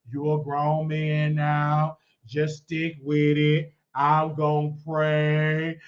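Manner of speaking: slow, drawn-out grandmother's counsel; steady a grandchild, word by unhurried word. Retold or imitated. Grandmother answering her crying grandson, slow counsel. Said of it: "I called my grandmother".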